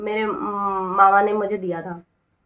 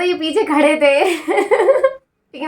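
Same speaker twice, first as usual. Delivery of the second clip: slow, drawn-out words breaking into laughter; amused, laughing retelling; laughing while telling; 5 semitones higher, 4 dB louder, much slower than usual. Real, not acted. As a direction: tell it through giggles, stretching the words.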